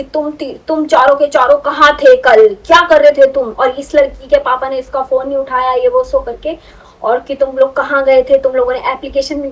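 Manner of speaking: high-pitched yelling. Retold or imitated. Warden scolding the four hostel girls, high loud yell. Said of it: "जितनी high pitch में वो चिल्ला सकती".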